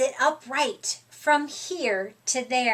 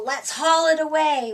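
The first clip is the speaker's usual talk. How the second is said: usual speaking voice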